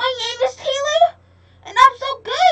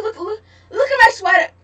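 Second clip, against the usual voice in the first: ordinary talk, nothing marked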